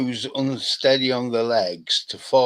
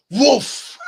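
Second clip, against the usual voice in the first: startled woof. Real, not acted. Exclaims at the oil fire.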